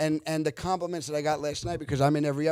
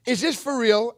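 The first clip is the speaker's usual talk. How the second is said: high, loud incredulous question; retold disbelief; 8 semitones higher, 7 dB louder than usual. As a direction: ask as if you suspect a prank. Retold disbelieving call to film academy.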